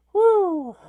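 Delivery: loud, high-pitched "woo"; whoop. Acted. Host whooping in relief as it feels much better, loud whoop. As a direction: let out a big high whoop.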